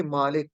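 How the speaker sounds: मालिक with a long first a, maa-lik; long a vowel. Shown as a demonstration. Teacher models मालिक for students with long maa. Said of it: "खड़ी जबर का मतलब है कि ये अलिफ के बराबर होती है".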